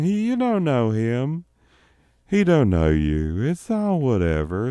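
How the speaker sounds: slow, high, sing-song laid-back greeter; easygoing drawl. Shown as a demonstration. Comedian offering to finish the bit in a laid-back drawl. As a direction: greet everyone lazily like a chill host. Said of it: "What if I did the rest of it like this".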